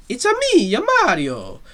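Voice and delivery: high, bouncy game-mascot impression; Mario. Fan contrasting the game voice with the Brooklyn one, high cartoon impression. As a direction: jump up to a cartoon Italian squeak. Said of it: "Mario voice that we all knew".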